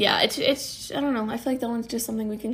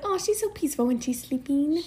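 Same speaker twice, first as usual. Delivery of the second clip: high, soft little coo; retold gushing tenderness in a small high voice; mock tender cooing; 4 semitones higher, 5 dB quieter than usual. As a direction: coo it sweetly, pitched up. Cooing over sleeping friend, retold.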